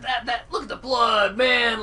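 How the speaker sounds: high, loud mock teenage thrill; hammed-up adolescent awe; excited teenage gore fan. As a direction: imitate a teen marvelling at gore. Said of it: "that teenage violent, like".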